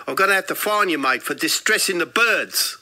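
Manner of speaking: fine them in hammed-up South African; put-on South African. Kiosk bloke fining tourist for distressing birds, South African accent. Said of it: "I'm sorry about the accent".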